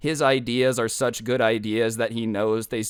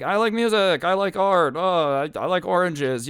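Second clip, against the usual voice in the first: put-on higher, a bit louder, simple childish listing; mock kid presentations; 7 semitones higher, 3 dB louder than usual. Classmates presenting simple hobbies, mock childish high voice. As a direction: play bored kids reading out what they like. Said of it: "most of them are like".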